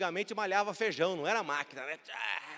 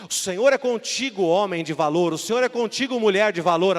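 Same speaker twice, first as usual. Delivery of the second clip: booming, much louder declaration; loud preaching proclamation; proclaimed loud; 9 dB louder than usual. Acted. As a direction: proclaim it over them with full force. Spirit proclaims to listeners.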